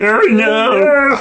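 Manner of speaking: high, loud mock "Oh no!"; mock cry of dismay. Mimicking the film character rolling downhill, high and loud. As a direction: yelp it like a cartoon victim.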